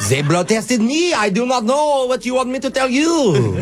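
cartoonish French accent, pitched high. Prank caller defending the diagnosis, in a cartoonish French accent. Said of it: "It sounds cartoonish".

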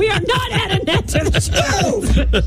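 high, slow laughing protest. Real, not acted. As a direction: laugh through the words. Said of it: "laughing protest".

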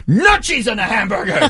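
high, laughing yell of the cheese Nazi; cheese Nazi impression. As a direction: shout it like a crazed food tyrant, laughing. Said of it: "the cheese Nazi from Seinfeld is".

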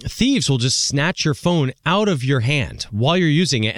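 ordinary talk, nothing marked

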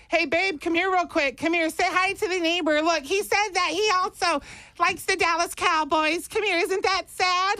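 very high put-on woman's voice; mocking girlfriend. Girlfriend showing him off to the neighbor, mocking high voice. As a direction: mimic a girlfriend in falsetto, teasing. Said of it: "your girlfriend or wife is like".

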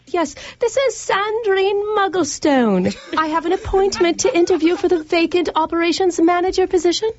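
mock-British interviewee; fake English accent. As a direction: ham up a posh English accent. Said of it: "surprised by your accent".

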